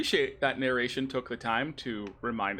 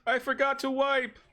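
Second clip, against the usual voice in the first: high, loud joking holler; mock calling out; 14 semitones higher, 5 dB louder than usual. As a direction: shout it high like a frantic call after someone. Mimicking character shouting after someone.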